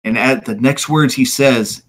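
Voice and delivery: ordinary delivery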